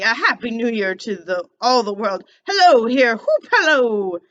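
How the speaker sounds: put-on old Scrooge, loud and giddy with joy. Scrooge calling out in delight on Christmas morning. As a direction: bounce the words out like a thrilled old man.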